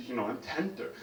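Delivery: usual speaking voice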